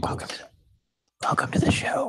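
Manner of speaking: whispered welcome; hushed breathy greeting; whispering. Pretend. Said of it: "We're just whispering the whole show now".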